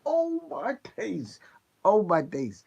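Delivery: drawn-out, high-pitched rave, then punchier; blown away. Real, not acted. Fan raving about amazing Caribbean food, blown away. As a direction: savour it in amazed exclamation.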